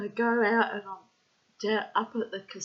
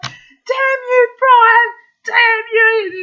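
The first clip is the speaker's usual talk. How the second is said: mock outrage; 16 dB louder, much slower than usual.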